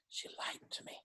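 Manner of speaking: hushed joking confession; conspiratorial whisper aside. Real, not acted. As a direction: drop to a whisper for the punchline. Joking aside about his age.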